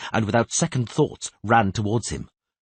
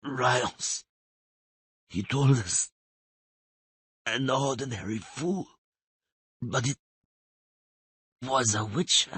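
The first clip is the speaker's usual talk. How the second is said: dying weak voice; 5 semitones higher, 4 dB quieter, slower than usual. Acted. Dying man speaks weakly.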